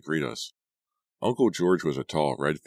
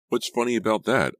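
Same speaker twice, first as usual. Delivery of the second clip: sharp, higher, louder challenge; angry demand; 7 semitones higher, 5 dB louder than usual. A narrator's reading. Girl rounding on Todd over the joke, angry demand. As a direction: snap back, angrier than meant. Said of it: "sounding more angry than I'd intended".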